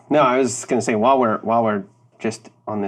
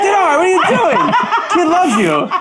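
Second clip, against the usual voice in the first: very high, loud, laughing scold; mock-scold your friend through laughter; laughing protest; 6 dB louder than usual. Scolding Dinar for rejecting the kid, laughing high. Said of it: "You're like".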